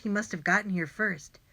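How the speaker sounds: ordinary delivery, nothing marked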